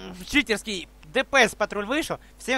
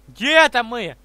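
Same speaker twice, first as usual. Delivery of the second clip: normal talking voice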